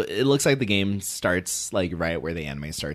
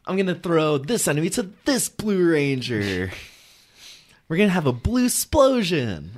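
excited roleplay voice; 10 semitones higher, 5 dB louder than usual. Retold or imitated. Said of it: "It's like".

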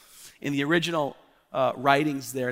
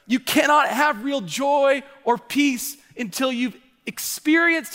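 higher, louder preacher's push; fiery preaching; 7 semitones higher, 4 dB louder than usual. Acted. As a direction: preach it hard at the congregation. Preaching builds to full force.

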